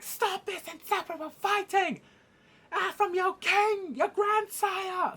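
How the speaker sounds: loud, high, drawn-out command; royal outburst. Acted. King ordering his family to stop, loud outburst. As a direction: bellow slowly in a high put-on voice. Acts out the king halting fight.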